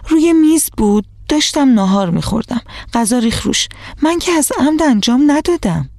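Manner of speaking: whiny insistence she meant no harm; child complaining to her friend's mother; resentful. A narrator's reading. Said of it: "روزا هم با دلخوری گفت".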